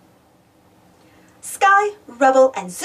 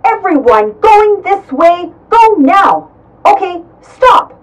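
loud, slow commands through a megaphone; 5 semitones higher, 11 dB louder, slower than usual. Acted. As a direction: direct traffic through a megaphone. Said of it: "got straight to work with his megaphone".

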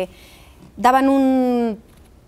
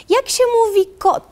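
put-on higher, lightly childlike sister's question in Polish; older sister asking; 11 semitones higher than usual. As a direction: play the big sister quizzing her little sibling. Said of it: "Entonces me dice".

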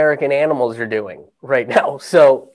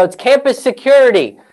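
loud, higher announcement through the door; mock security guard calling out; stern official call; 9 semitones higher, 4 dB louder than usual. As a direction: announce yourself with authority.

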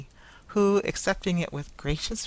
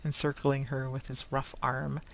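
plain reading voice

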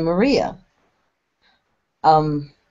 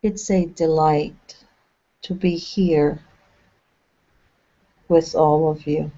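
unhurried guide voice with long pauses between phrases; measured, spacious phrases, leaving silence to settle; guided meditation. Acted. Channeling a meditation for listeners relaxing along a path, guided meditation.

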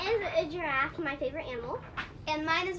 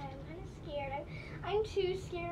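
ordinary talk

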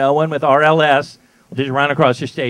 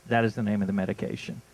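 normal talking voice

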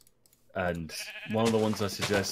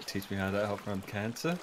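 ordinary talk

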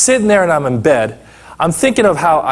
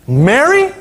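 Mary sung out much higher with a rise; high rising call of a name; 10 semitones higher than usual. Acted. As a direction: lift the name up high like calling across a room. Calling out the sister's name.

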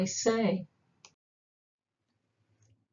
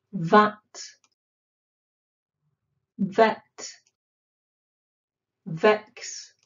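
ordinary talk

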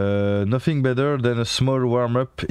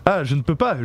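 normal talking voice

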